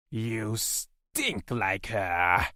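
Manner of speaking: slow, drawn-out creature voice; menacing alien, very unhurried; alien captor. A narrator's reading. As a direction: stretch the accusation out slowly. Alien captor taunts his prisoner.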